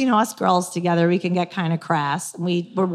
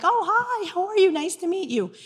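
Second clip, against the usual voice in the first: higher, sugary surprised hello; sweet polite greeting; 10 semitones higher, 4 dB quieter than usual. Retold or imitated. To the Facebook guy, a much higher mock-polite hello. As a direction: play it overly sweet to a stranger. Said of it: "And I'm like".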